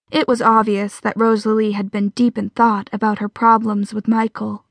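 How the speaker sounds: usual speaking voice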